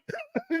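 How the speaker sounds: high squeaky laugh. Real, not acted. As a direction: cackle at yourself. Laughs after his shout.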